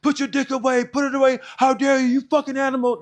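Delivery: high-pitched, loud drill instructor tirade; imitated outraged yelling. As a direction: yell it like instructors in his face. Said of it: "just yelling".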